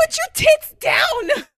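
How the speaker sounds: high, loud, slow barked joke command; mock shouted order. Cohost yells at the host to put her tits down, mock shouting. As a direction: bellow it like a drill sergeant.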